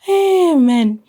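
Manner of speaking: high, loud exasperated cry; exasperated hey. Real, not acted. Exasperated exclamation.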